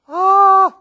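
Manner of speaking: sudden high loud cry of fright; a sharp gasping shout of terror; startled scream. Acted. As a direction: yelp as if an angel appeared. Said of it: "the usual human reaction when you saw an angel".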